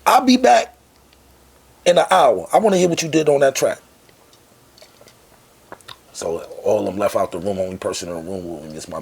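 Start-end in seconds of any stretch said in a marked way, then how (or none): 0.0-3.8 much higher, louder mocking mogul voice; incredulous boss. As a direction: look him up and down in disbelief. Boss leaving with instruction.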